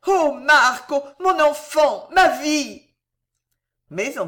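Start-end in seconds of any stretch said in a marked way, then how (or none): 0.1-2.9 high, loud anguished cry. A narrator's reading. Mother crying out loud for her son. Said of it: "elle cria en joignant les mains".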